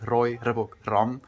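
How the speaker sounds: Roy Robogram with trilled R's; rolled R's. Shown as a demonstration. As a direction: roll each R in Roy Robogram. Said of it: "the rolling of the R's".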